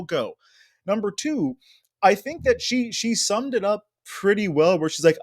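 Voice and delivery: ordinary talk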